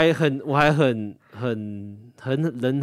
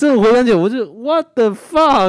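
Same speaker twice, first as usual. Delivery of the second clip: stunned outrage; 14 semitones higher, 12 dB louder than usual. Real, not acted. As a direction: drag out the shock. Recalling shock at ex. (拉长声音震惊地说)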